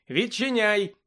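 high-pitched, drawn call to open up; shouted demand. A narrator's reading. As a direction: call insistently through a door. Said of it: "закричав Гаррі, гатячи у двері".

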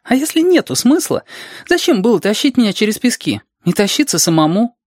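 put-on high, hurt child voice; sulky, whiny child. A narrator's reading. Reshka reproaching Alexander for carrying him, sulky child voice. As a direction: grumble high like a petulant child. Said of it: "Решка пробубнил обиженно и капризно".

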